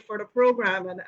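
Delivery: ordinary talk, nothing marked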